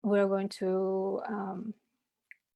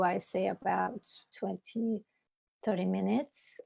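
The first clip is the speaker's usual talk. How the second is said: ordinary talk, nothing marked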